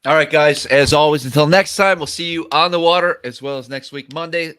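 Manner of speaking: loud upbeat sign-off to viewers; show sign-off. Acted. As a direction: boom it out to the audience.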